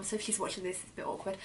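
sheepish whisper, dropping to nothing; whispered aside. Real, not acted. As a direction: whisper it like she might hear. Aside about mum watching.

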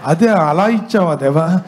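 slow, laughing through the words; amused, drawn-out realisation; laughing disbelief. Acted. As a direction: chuckle as the penny drops. Youth realises God's joke.

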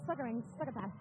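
exaggerated lisp on "Sucker ring, sucker pass"; lisping "Sucker ring, sucker pass" through a grill. Shown as a demonstration. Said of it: "nothing gangster about being like".

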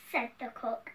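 usual speaking voice

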